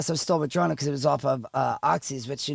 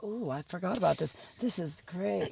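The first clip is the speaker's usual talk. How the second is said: softer, dreamy savoring; drugged bliss; 10 dB quieter than usual. Retold or imitated. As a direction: back on opioids at the hospital, blissed-out murmur. Relishing the drug shot again.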